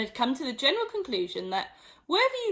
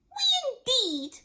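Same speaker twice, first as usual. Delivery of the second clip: slow, much higher outraged squeak; shrill passionate mouse; slower than usual. Acted. Mouse indignant at Alice. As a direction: squeak it in disbelief. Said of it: "cried the mouse".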